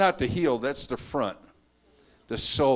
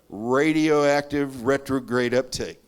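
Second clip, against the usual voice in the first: slow, louder, deliberate articulation; careful enunciation; 4 dB louder, slower than usual. Shown as a demonstration. Showing the audience a word needing sobriety, enunciated carefully. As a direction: take the tricky term slowly and carefully. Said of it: "you have to be sober to say that word".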